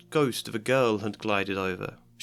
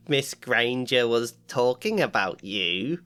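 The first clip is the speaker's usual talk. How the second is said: sly. Acted. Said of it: "said Peeves slyly".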